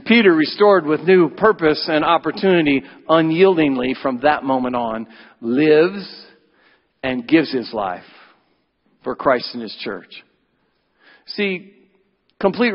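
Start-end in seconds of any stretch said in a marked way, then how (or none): none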